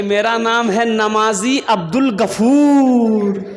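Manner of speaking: slow, grandly drawn-out introduction; self-important worshipper. Retold or imitated. Worshipper answers the Haji with his own title, self-important. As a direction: stretch the last name out pompously.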